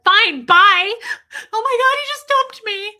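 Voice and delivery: high, loud panicked squeal; hammed-up panic at being dumped; mock freak-out. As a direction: play your old overreaction, shrill and frantic. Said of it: "I freak the fuck out".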